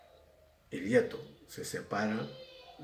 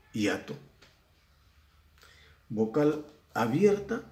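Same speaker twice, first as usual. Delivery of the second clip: ordinary talk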